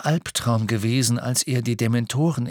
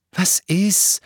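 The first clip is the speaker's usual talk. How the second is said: irritated, higher and louder; growled challenge; 9 semitones higher, 5 dB louder than usual. A narrator's reading. Ron turns on Hermine after kicking a chair, growling. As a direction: throw it at her crossly. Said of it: "knurrte er Hermine an".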